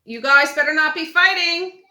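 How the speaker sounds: loud parental holler, pitched high; yelled warning. Real, not acted. Yells upstairs at the kids.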